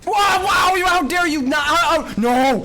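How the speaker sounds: high outraged yelling; outraged shouting. Real, not acted. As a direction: yell in shocked outrage. Outraged at game enemy.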